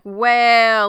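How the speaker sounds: accusing well. Retold or imitated. Floss confronting Lenny.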